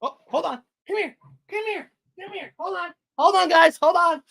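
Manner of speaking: high coaxing calls; calling a pet. Real, not acted. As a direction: call the little chicken over, high and sweet. Calling chicken/pet to come.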